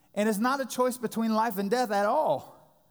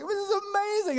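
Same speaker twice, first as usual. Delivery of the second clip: overjoyed; 12 semitones higher than usual. Retold or imitated. Holding newborn son, overjoyed.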